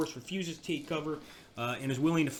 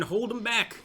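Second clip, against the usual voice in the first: raised, urgent call to the troops; rallying the men, a raised call; 9 semitones higher, slower than usual. Real, not acted. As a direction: push them to hold the line. Urging troops on in battle.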